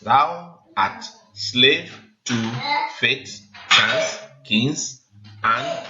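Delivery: slow, evenly spaced syllables, one beat at a time; counting syllables aloud. Shown as a demonstration. Teacher counts syllables with class, slowly and deliberately. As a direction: tick off each syllable as you say it. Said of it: "count with me".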